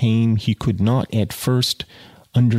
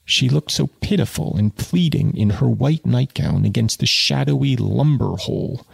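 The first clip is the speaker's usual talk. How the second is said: ordinary delivery, nothing marked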